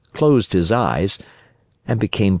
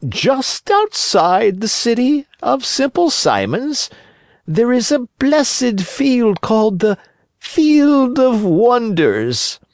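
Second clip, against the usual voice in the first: high, slow, honeyed fox voice; sly fox; 4 dB louder than usual. Acted. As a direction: spin the tale to lure the puppet. Fox spins tale.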